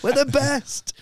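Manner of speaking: high, crowing crowd voice; mock self-congratulation. American audience agreeing with praise, gleefully mocked. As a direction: crow it like a flattered crowd.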